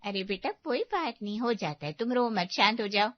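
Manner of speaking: calm a crying daughter down; gentle, low-pitched comfort; soothing. Acted. Mother soothes crying daughter on phone.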